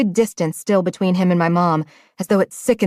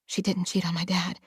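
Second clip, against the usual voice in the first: quiet; 11 dB quieter than usual. A narrator's reading. Hushed inner denial.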